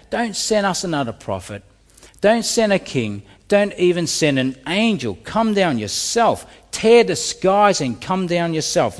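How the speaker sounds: bold, high-pitched appeal to God; audacious demand. Retold or imitated. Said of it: "you hear the audacity of that".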